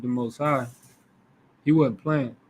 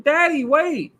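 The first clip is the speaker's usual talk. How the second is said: put-on much higher, a young boy calling his father back; pleading little boy; 10 semitones higher, slower than usual. The boy calling after his father, higher and pleading. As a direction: plead like a confused child.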